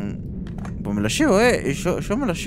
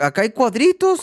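ordinary talk

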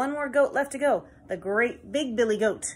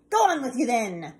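grumpy troll; 6 dB louder than usual. Acted. Troll lets goat pass.

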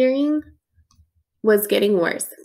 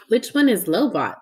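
usual speaking voice

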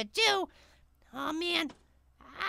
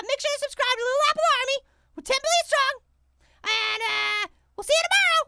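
cheery sign-off; 5 semitones higher than usual. Real, not acted.

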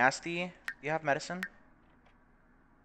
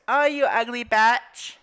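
loud, higher, slow taunting jeer; mocking jeer; 9 semitones higher, 8 dB louder, slower than usual. Shown as a demonstration. Showing what a jeer sounds like, loud and mocking. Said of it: "Like that".

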